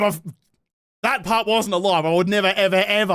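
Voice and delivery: ordinary talk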